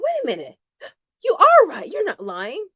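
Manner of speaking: mock shocked admission; startled realization. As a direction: catch yourself mid-argument, amazed. Realizing the IRS was right.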